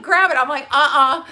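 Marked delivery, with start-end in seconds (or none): 0.0-0.4 laughing. Retold or imitated. Retelling being scared on ledge. 0.7-1.2 laughing. Retold or imitated. Refusing his hand, laughing.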